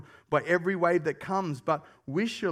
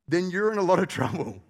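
usual speaking voice

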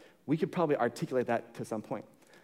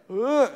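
mock groan; 10 semitones higher, 7 dB louder than usual. Driver reacts to pastor news.

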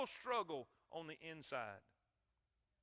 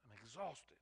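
much softer, worn-out voice; preacher voicing Paul's frustration, much softer and weary; weary exhaustion; 11 dB quieter than usual. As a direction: speak as if drained after battle. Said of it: "He's just like".

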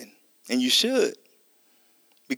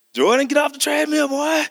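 a much higher shouted order, aggressive and stern; more stern; 11 semitones higher than usual. Retold or imitated. A father shouting at his son to get off, stern. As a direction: yell it like you've lost patience. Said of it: "a little more aggressive and ... more stern".

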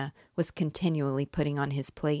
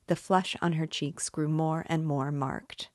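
ordinary delivery

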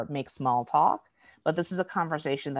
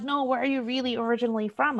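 high-pitched mock curious questioner; insistent questioner; 9 semitones higher, faster than usual. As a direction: imitate a nosy stranger, pitched well up. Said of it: "there are days when I am doing fine".

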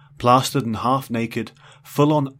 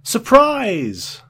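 surprised shout; 13 semitones higher, 9 dB louder than usual. Acted. Sudden loud exclamation.

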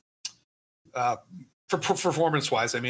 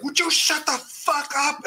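higher, slow mock furious outburst; imitating an angry yell; 11 semitones higher than usual. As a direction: ham up the actor blowing his top. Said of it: "He is so angry".

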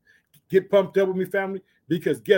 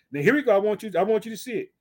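normal talking voice

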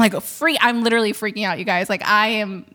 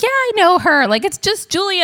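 much higher, breezy and offhand; casual friend; 9 semitones higher than usual. Retold or imitated. Friend shrugging off the famous name, breezy. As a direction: toss it off like it's no big deal.

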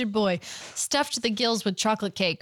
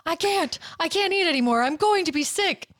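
put-on pleading boy; 6 semitones higher than usual. A narrator's reading. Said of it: "stop and cry out".

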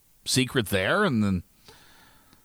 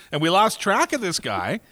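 ordinary talk, nothing marked

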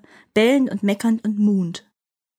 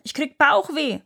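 whiny giant elephant; 6 semitones higher than usual. Acted. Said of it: "schrie er".

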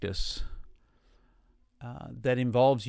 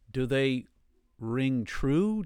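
ordinary delivery

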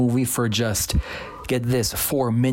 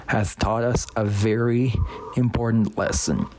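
ordinary delivery

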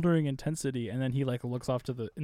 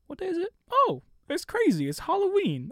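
much higher, squeaky startled self-quote; high mock surprise; 6 dB louder than usual. Retelling waking up on Halloween, high squeaky surprise. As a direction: squeak it out like you just realized the date. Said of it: "I woke up and was like".

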